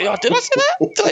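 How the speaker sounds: very high, laughing innocent act; mock innocence. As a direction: play dumb in a squeaky laughing voice. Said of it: "'Cause you're like".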